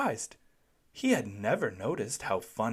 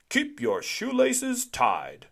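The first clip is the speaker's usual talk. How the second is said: high, slow, deliberate recital; slow, high safety-officer voice; Officer Buckle's tip; 12 semitones higher, 5 dB louder, slower than usual. A narrator's reading. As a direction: spell out the rule slowly like a serious officer.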